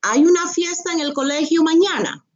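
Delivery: Hay una fiesta en el colegio mañana pitched high and fast as a question; asking tone. Shown as a demonstration. Teacher modelling the question form after the statement. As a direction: lift it into a question. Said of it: "Now ask the question".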